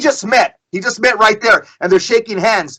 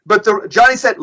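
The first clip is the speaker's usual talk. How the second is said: ordinary talk, nothing marked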